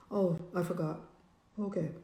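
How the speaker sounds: low, very soft, small voice; sheepish reply. Retold or imitated. Imitating partner admitting the light was forgotten, sheepish. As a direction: mumble it low like someone caught out.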